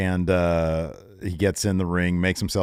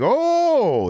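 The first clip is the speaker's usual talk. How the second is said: excited oh; 4 dB louder than usual. Retold or imitated. Brock thrilled by MVP's pitch.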